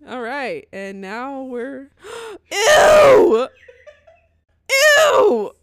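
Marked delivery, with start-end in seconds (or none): 2.5-5.5 loud, high squealed ew; disgusted shriek. Real, not acted. Reacting to the squashed spider, disgusted shriek. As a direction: shriek in revulsion.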